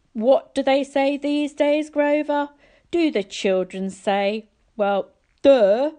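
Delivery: mock the slow kid; sarcastic god. A narrator's reading. God mocking the boy.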